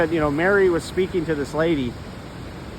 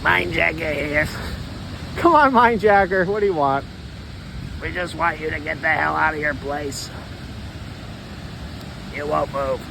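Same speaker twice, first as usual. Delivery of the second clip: lower, gruff-ish voice of the intruders; less femmy voice; 3 semitones lower, 4 dB quieter than usual. Acted. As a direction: speak as the squatting voices, lightly. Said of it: "One that's not so, uh, femmy".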